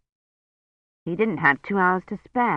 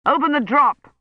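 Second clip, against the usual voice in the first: raised, urgent call; half-voiced urgent shout; 7 semitones higher, 4 dB louder than usual. A narrator's reading. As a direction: call to the lab to open it. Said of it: "he shouted".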